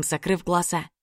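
ordinary delivery

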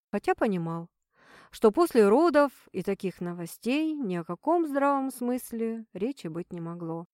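ordinary delivery

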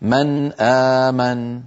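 slow من آمن with the ن fully pronounced; clear nun. Shown as a demonstration. Teacher repeating the example من آمن. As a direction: stretch من آمن, ن kept before hamza. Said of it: "نحو".

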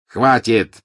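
loud sharp command; shout. A narrator's reading. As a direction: bark the order. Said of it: "крикнул он".

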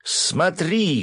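high, exultant cry; gleeful shout. A narrator's reading. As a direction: shout it in triumph. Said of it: "громко хохоча закричал хранитель". (высокий ликующий крик)

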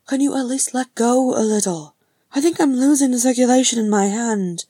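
loud complaint. Acted. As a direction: plead to be let go. Dragged brother complains of grip.

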